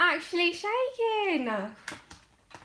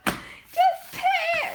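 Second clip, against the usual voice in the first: excited exclaim; 4 dB louder, much slower than usual. Retold or imitated. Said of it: "I am just like".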